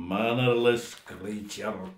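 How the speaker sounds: low, very slow contemptuous mutter; father grumbling to himself in disgust; muttered disdain. A narrator's reading. Offended father muttering to himself, low and contemptuous. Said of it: "ते स्वतःशीच पुटपुटले".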